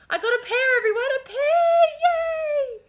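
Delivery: announcing a trivial win with hammed-up joy; mock excitement. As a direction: fake a big delighted cheer. Mock-cheery celebration to audience.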